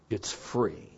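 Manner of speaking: suddenly quiet, low reassurance; hushed punchline; soft aside. Acted. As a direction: drop the voice after the shouting. Drops voice after shouted offer.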